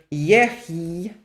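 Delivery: yehi with a bare yod, no vowel after y; demo form. Shown as a demonstration. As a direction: say yehi as y plus hi, the yod unvoweled. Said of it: "this is what would have happened here".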